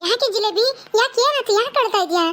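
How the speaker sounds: high, anxious questions to a crying woman; worried asking. Acted. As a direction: ask fast and worried, voice up. Sister-in-law sees Jilebi crying. (ಅಳುತ್ತಿರುವ ಜಿಲೇಬಿಯನ್ನು ಆತಂಕದಿಂದ, ಎತ್ತರದ ದನಿಯಲ್ಲಿ ಕೇಳುವುದು)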